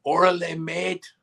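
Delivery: high mash-up of Spanish slang and Aussie accent; bad Aussie accent. Shown as a demonstration. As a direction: try Aussie and miss, in a high voice. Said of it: "Oh, let's do this one".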